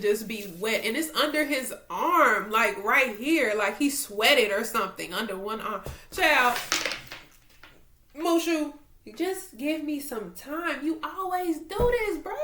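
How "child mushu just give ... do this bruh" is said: whiny pleading. Real, not acted. Pleading with interrupting dog.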